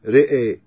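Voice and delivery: رئي said "re'ay", both vowels tilted to e; e-coloured vowels throughout رئي; imala. Shown as a demonstration. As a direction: tilt ra and hamza in رئي toward e. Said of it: "أمال الراء والهمزة والألف".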